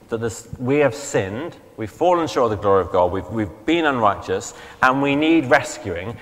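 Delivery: usual speaking voice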